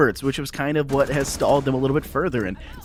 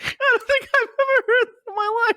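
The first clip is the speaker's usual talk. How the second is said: high, squealing laughter through the words; helpless laughter; 4 dB louder than usual. Real, not acted. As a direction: barely get the words out for laughing. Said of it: "stop laughing".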